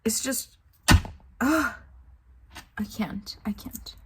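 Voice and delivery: whispered, breathless giving up; defeated whisper. Real, not acted. Fed-up reader whispering she can't take the book anymore. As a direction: let it fall away to a whisper.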